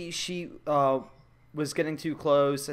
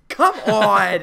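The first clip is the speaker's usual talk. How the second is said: louder exasperated come on; catchphrase shout; 4 semitones higher, 9 dB louder than usual. Pretend. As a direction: punch it out. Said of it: "she just says".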